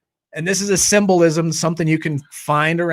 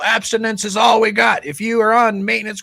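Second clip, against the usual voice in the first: higher, shouted zealot's declaration; mock shout; 6 semitones higher than usual. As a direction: bark it like a rigid meeting regular. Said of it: "You got A A people".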